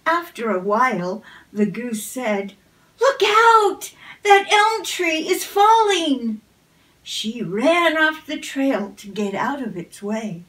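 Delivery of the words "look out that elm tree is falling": much higher, louder cry of alarm; alarmed warning. A narrator's reading. Goose warning the fox of a falling tree, alarmed shout. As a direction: shout a warning to save him.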